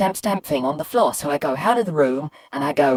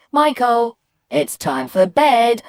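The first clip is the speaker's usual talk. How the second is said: higher, louder call, "bed" drawn out; calling mum; 6 semitones higher, 5 dB louder, slower than usual. Retold or imitated. Mum sending Michael to bed, loud calling. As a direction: call it out in a raised, insistent voice. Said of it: "So when my mum said".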